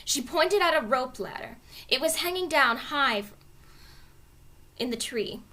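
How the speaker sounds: plain reading voice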